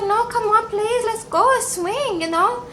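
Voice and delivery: wheedling high voice begging her man to swing; pleading. Retold or imitated. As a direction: coax him like a begging girlfriend. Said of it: "And she's like".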